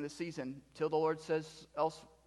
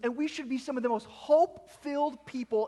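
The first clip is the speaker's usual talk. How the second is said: rising, impassioned pulpit appeal; building preaching; 9 semitones higher, 5 dB louder than usual. Real, not acted. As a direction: climb in pitch to a high peak on "planet".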